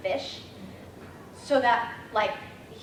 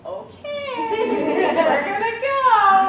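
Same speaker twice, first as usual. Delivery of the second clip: much higher, louder, drawn out slowly; excited squeal; 13 semitones higher, 11 dB louder, much slower than usual. Retold or imitated. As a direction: squeal it like a giddy child. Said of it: "And I'm like".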